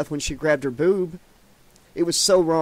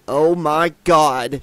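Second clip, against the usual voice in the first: slow, louder shocked cry; shocked yell; 6 dB louder, much slower than usual. A narrator's reading. Kelly seeing Seaver naked, a slow shocked yell. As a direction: drag it out in loud shock. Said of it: "she yelled".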